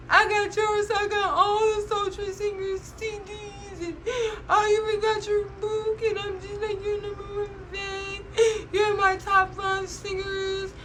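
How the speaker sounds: high, breathless gushing fan voice, near tears; gushing fangirl. Retold or imitated. Fan gushing to her favourite singer, high and teary. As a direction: pour it out like a starstruck fan meeting her idol.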